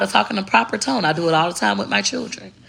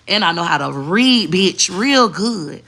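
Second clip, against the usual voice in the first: louder and slow, "bitch, real good" drawn out with sass; 4 dB louder, slower than usual. Shown as a demonstration. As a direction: lean hard on "real good" like a clapback. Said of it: "I know how to do both".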